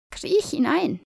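high bossy witch order. A narrator's reading. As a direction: command her into the oven. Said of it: "sagte die Hexe".

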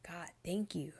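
soft whispered thanks to God; whispered prayer. Retold or imitated. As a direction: whisper it through tears. Crying in bed, praying.

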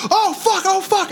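very high panicked "oh fuck"; retold panic. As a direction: squeal in mock terror. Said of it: "And I'm like".

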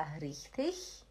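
richtig ending in a soft ich sound. Shown as a demonstration. Teacher showing Lacerda the first option, soft ch ending. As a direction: say richtig with a final hiss like ich. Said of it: "tem duas opções".

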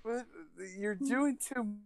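high, needy woman's voice rising to a squeal; girl voice. Retold or imitated.

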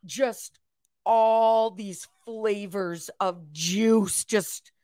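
slow, drawn-out savoring; rapturous tasting food. Acted. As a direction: linger over each word, tasting. Savoring a cocktail sip on camera.

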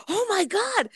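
high-pitched mock alarm. Masseuse replaying her shock at the ants, alarmed. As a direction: exclaim in acted horror, voice shooting up.

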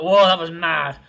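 loud, buzzing rush of excitement; thrilled whoa. Retold or imitated. Recalling a theme park ride, he bursts out loudly thrilled. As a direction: burst out like you just came off the ride.

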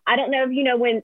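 usual speaking voice

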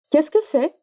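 "Qu'est-ce que c'est" lifted at the end; teacher's model question tune on "Qu'est-ce que c'est"; rising question intonation. Shown as a demonstration. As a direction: lift the voice on "c'est", asking. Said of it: "Presta bem atenção na entonação".

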